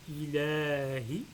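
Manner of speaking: ILAHI slowly, with the la held long; prolongation example. Shown as a demonstration. Teacher illustrates small alif for learners, long drawn-out vowel. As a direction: stretch the la of ILAHI. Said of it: "par exemple, premier mot, on dit".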